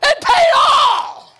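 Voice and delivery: high, slow, shouted command; furious order. Acted. The servant orders the debtor thrown in prison, shouting high. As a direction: hurl the order at the guards.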